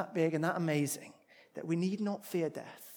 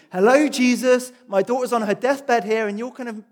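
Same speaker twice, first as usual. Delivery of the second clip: raised, exasperated complaint, higher and louder; imagined fuming; 7 semitones higher, 14 dB louder than usual. Retold or imitated. Jairus, a desperate father, hurrying Jesus along, exasperated and raised. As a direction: protest at Jesus like a frantic father. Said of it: "could be legitimately fuming".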